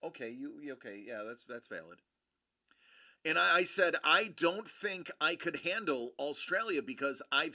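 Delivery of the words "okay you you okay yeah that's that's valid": gentle agreement. Real, not acted. Accepts child's answer, aside.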